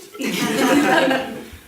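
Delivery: high breathy giggle; giggling laugh. Real, not acted. Comedian laughing at his own joke.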